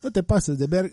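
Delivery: Mexico City accent, "ver-" cut off mid sing; light chilango singsong; Mexico City sing-song. Shown as a demonstration. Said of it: "like they sing like that".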